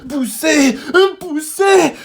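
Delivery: very high, loud, straining; skidding on ice, gasping orders; out of breath. A narrator's reading. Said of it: "s'essouffle Zigomar".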